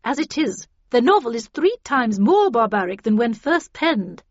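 loud, forceful lecture; cold, stern warning. A narrator's reading. Havisham warns the characters to contain their anger, sternly. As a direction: lecture the group severely, stressing each danger. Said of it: "said Havisham coldly".

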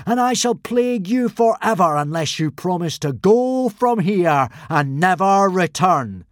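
loud, high solemn pronouncement; solemn boom. Acted. Fake god solemnly booming at the consul. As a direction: boom it gravely. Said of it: "boomed Hiccup solemnly".

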